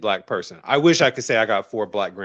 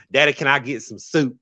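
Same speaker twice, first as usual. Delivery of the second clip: put-on childhood Southern accent; 3 semitones higher than usual. Shown as a demonstration. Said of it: "I had a very strong Southern accent".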